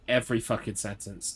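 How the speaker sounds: normal talking voice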